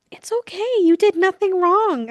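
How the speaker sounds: high-pitched imitation of soothing him; mock reassurance. Val going back to comfort David, high mock-soothing voice.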